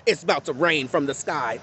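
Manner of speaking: ordinary delivery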